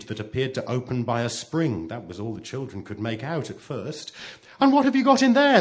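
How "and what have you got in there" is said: high, loud, quick eagerness; bursting with curiosity, high and fast; eager question. A narrator's reading. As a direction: ask in an excited childlike rush. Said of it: "they asked eagerly".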